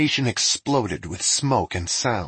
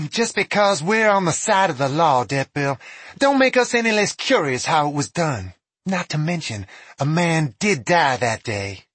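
raised, firm woman sheriff; 7 semitones higher, 4 dB louder than usual. Acted. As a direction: press the point as sheriff. Sheriff character voice.

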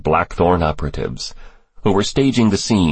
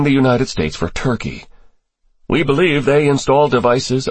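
higher-pitched character voice for testimony; briefing official; 6 semitones higher than usual. Acted. Official briefing senators on terrorist ties, higher character voice. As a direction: lay out the intelligence in a raised register.

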